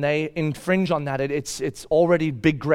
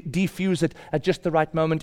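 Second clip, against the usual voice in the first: ordinary delivery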